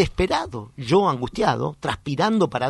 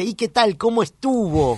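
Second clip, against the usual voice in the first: higher, drawn-out breezy question; voicing the employee; 7 semitones higher than usual. Employee asking the therapist how the session went, voiced higher. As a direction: ask it high, stretching the last words. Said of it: "cuando termina la sesión me dice".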